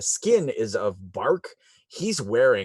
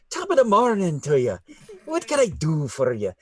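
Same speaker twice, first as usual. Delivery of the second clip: Irish fey greeting; 6 semitones higher, faster than usual. Acted. GM voicing the tiny fey guardian greeting the party, chirpy Irish lilt.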